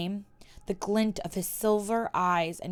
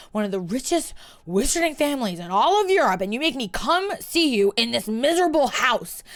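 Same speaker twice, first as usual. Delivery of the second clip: louder, higher, scornful; bitter tirade; 5 semitones higher, 5 dB louder than usual. Acted. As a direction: lash out at him. Hermione berates Malfoy.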